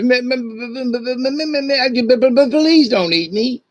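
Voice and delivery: high, stammering plea not to be eaten; terrified porcupine. Acted. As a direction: stutter out a panicked plea. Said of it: "Porcupine looks at him and says".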